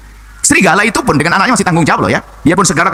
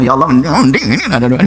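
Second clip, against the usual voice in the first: chuckling while imitating a grumbling prayer; laughing mock prayer. Preacher mocking a complaining worshipper, laughing. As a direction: laugh through a mimicked petitioner.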